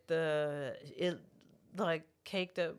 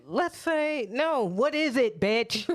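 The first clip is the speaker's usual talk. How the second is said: high, louder mocking retort; mocking let's say; 7 semitones higher, 6 dB louder, slower than usual. To friend, ranting about hypotheticals, loud high mock. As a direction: snap back high and loud. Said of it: "I am so tired of let's say".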